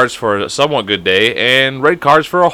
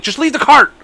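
frantic yell; 12 semitones higher than usual. Retold or imitated.

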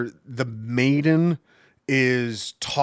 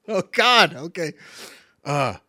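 ordinary talk, nothing marked

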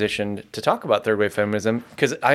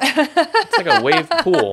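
high, slow and laughing; high laughing joke; 5 dB louder, slower than usual. Acted. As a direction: squeal it out with a laugh. Joking aside.

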